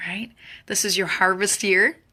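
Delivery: ordinary talk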